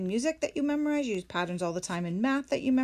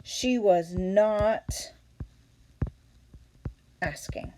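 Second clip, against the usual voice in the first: She was not asking spoken very slowly, fading out at the end; slow model answer; much slower than usual. Shown as a demonstration. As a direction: lay out the sentence slowly for students. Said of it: "this is where we put in the not".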